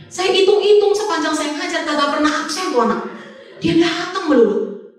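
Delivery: high-pitched, exasperated complaint. Retold or imitated. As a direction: complain in mock despair, dragging out the last words. Exasperated at pupil's constant attendance.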